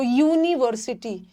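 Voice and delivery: यूनिवर्सिटी slowly, starting with a clear yoo; yoo sound. Shown as a demonstration. Teacher showing students the yoo in यूनिवर्सिटी, slow and clear. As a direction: say यूनिवर्सिटी as yoo-niversity, drawn out. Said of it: "यहाँ यू का pronunciation देखो".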